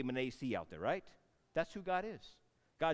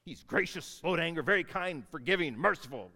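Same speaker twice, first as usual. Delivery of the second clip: mock complaint; 3 semitones higher, 7 dB louder than usual. Shown as a demonstration. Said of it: "you would not describe them like".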